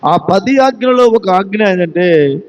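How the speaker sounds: usual speaking voice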